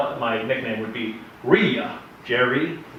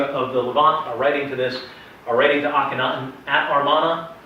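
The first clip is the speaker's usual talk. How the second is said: ordinary talk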